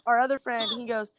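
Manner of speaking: usual speaking voice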